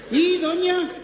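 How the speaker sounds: "Y doña" held high and level; high, hanging "doña"; puzzled misreading. Acted. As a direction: misread "Y doña" as two words, high and suspended.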